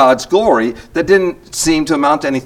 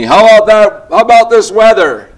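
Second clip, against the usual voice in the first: louder, higher mock chatty voice; loud small-talk quote; 7 semitones higher, 7 dB louder than usual. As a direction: call it out like a stranger in a noisy waiting room. Man at clinic chatting loudly.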